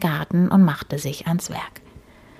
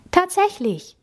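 very high, amazed exclamation; surprised, soaring pitch; exclaiming; 12 semitones higher than usual. A narrator's reading. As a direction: cry out in high-pitched astonishment. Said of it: "rief sie".

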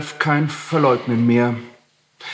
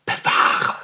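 breathy dismissal. A narrator's reading. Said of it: "sagte Heinrich".

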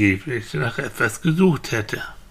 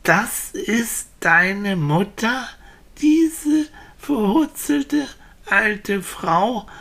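slow, higher, disbelieving question; incredulous villagers; 8 semitones higher, much slower than usual. Acted. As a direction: stretch each word in astonishment. Villagers cannot believe it. (ungläubig staunend, gedehnt)

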